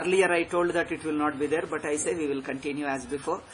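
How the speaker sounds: ordinary talk, nothing marked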